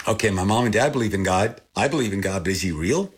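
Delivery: ordinary talk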